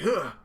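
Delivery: loud high grunt; pained grunt. Retold or imitated. Sanji taking the blow, loud high grunt. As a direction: grunt from the hit. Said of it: "Sanji's like".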